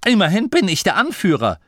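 high, quick, self-important protest; indignant complaint. Acted. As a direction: insist on your rank, offended. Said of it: "beschwerte er sich". (beleidigt auftrumpfend)

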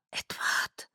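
hushed, breath-only whisper; whispered name. A narrator's reading. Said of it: "flüsterte ich".

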